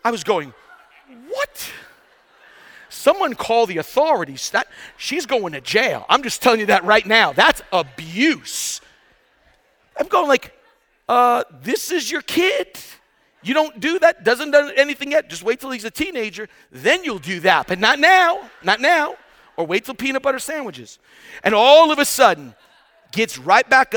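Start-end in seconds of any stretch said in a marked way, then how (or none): 1.3-1.7 high, squeaky disbelieving "what"; mock shock. Preacher reacting to a giraffe kicking her newborn, mock shock. 2.9-8.8 comically indignant protest, pushed up a little; mock outrage. Preacher reporting the mother giraffe for abuse, mock outrage. 11.1-21.0 high-pitched, incredulous comic protest; mock scolding. Preacher telling off the giraffe mother, mock scolding.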